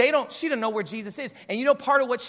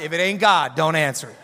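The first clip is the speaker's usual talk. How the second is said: louder, punchy line with a laugh; saying it right; 7 semitones lower, 6 dB louder than usual. Pretend. Pastor redoes the phone quip properly, louder and laughing. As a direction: land the quip properly this time. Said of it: "so I can say that line right".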